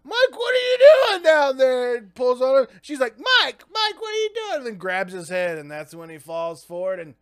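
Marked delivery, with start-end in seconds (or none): 0.0-2.0 high, loud mock exclamation; high-pitched character cry; acted alarmed scolding. Acting out her finding Mike. 3.2-4.6 high-pitched mock pleading; hammed-up high female character; acted urgent calling. Acting out her shaking Mike.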